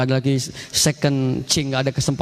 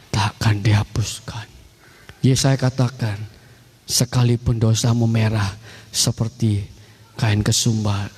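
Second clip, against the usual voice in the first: low, slow, measured preaching; solemn scripture; 6 semitones lower than usual. Acted. Preacher quotes God's verdict to congregation, low and solemn. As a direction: weigh each phrase slowly in a low voice.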